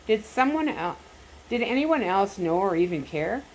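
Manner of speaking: usual speaking voice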